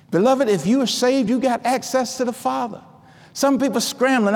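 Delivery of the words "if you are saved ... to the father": normal talking voice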